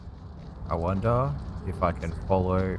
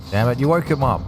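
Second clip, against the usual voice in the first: exasperated outburst; 7 semitones higher, 8 dB louder than usual. Real, not acted. An enemy wakes up.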